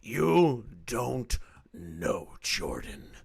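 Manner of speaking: slow gravelly Batman impression. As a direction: growl it slowly like Batman. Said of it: "the Batman villain voice".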